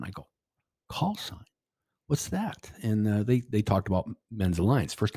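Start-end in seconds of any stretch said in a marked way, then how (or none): 0.9-2.7 high, quiet baffled question; puzzled surprise. Retold or imitated. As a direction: act clueless about the term, voice pitched up. Confused reply to strange question.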